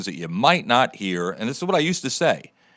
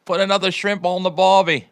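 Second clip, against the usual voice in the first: hammed-up Australian accent, pitched higher; a cartoonish Aussie, loud and cheeky; mock Australian accent; 8 semitones higher, 4 dB louder than usual. Cohost teasing the Aussie ferry story in a mock Australian accent. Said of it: "everybody in Australia wants to shoot you".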